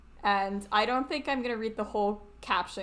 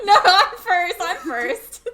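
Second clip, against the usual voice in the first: high, loud laughing protest; mock squabbling; 7 semitones higher, 11 dB louder than usual. Co-host contesting who introduces first, mock squabbling. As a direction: giggle and fight for first place.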